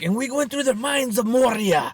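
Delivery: high, dramatic storytelling voice; hammy storyteller. Acted. Dad telling stories to his kid, hammy high storyteller. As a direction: put on an accent and play up the epic tale. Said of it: "trying to tell stories and doing accents".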